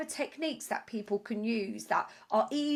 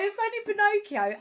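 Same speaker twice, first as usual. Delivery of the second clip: incredulous reassurance; 10 semitones higher, 5 dB louder than usual. Retold or imitated. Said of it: "she was like".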